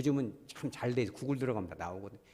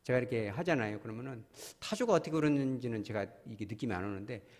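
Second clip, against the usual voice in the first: ordinary talk, nothing marked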